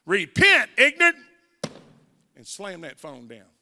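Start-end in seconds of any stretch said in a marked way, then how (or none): 0.1-1.2 much higher, louder sharp shout; stern command. Retold or imitated. Father ordering his son to repent, loud stern shout. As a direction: bark it and hang up. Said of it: "he said".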